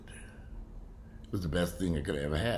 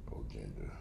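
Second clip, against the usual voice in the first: pitying softly; 11 semitones lower, 12 dB quieter than usual. Real, not acted. Pitying sick dog.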